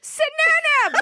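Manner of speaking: excited shout. Real, not acted. Amazed echo of word.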